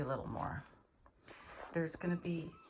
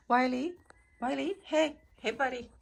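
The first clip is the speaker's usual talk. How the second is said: calling out; 6 semitones higher, 6 dB louder than usual. Real, not acted. Calling to the cat.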